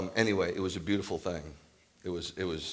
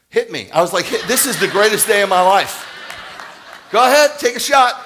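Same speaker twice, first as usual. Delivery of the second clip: much higher and louder, laughing through the taunt; gleeful dare; 22 dB louder than usual. Retold or imitated. Father retelling how he dared the garage attendant, laughing loud taunt. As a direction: dare him to swing, thrilled to bits. Retelling daring the rough guy.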